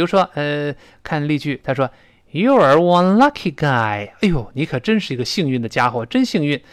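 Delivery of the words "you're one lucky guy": slow reading. Shown as a demonstration. Reads example sentence.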